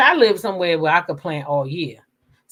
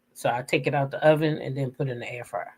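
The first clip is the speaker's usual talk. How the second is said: normal talking voice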